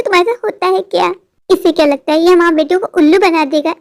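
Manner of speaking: ordinary talk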